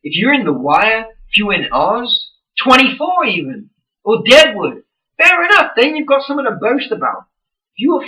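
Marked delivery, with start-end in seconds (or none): none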